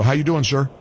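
normal talking voice

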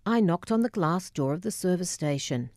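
ordinary delivery

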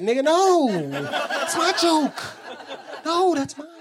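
very high mock-possessive whine; squealed protest. Last comedian guarding his joke, squealed mock protest. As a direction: jump way up high to claim it.

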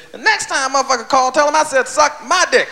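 punchy, dismissive cussing in a borrowed voice; Richard Pryor. Retold or imitated. Older comic advises a younger one by phone, mock indignation. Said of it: "And Richard said".

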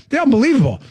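ordinary talk, nothing marked

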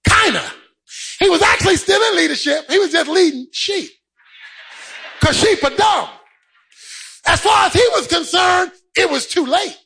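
high, driving sermon delivery; impassioned preacher in full cry; full-force preaching. Real, not acted. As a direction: preach it hard, punching out each phrase.

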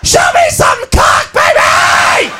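high, drawn-out raucous shout; hammed-up drunken holler; wild club girl. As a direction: scream at the stripper.

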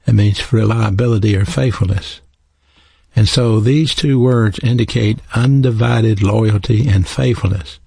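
ordinary talk, nothing marked

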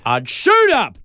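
high excited burst. Acted. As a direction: shoot up with sudden energy. Acting excited jump up.

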